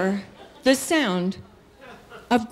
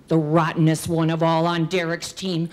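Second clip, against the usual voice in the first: ordinary delivery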